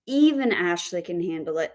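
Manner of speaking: EVEN lifted, the rest dropping away; stress on EVEN. Shown as a demonstration. Teacher demonstrates stress on the adverb, stress on EVEN. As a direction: punch EVEN, then let the line fall. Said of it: "put our word stress on the adverb even".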